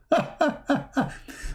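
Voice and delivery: high, bursting cackle; laugh. Real, not acted. Host amused at his own story, high cackling laugh. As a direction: run of amused high ha-has.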